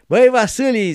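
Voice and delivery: loud high hearty call; Cleopa's call. Retold or imitated. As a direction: shout the boy's name warmly. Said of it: "zicea Cleopa".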